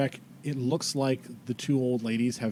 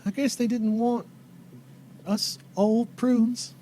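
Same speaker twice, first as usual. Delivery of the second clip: put-on higher, halting old-lady voice; self-pitying rustic old woman; old townswoman; 12 semitones higher, 5 dB louder, slower than usual. Old lady saying they were left behind, higher slow drawl.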